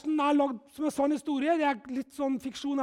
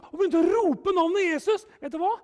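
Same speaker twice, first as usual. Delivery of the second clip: ordinary talk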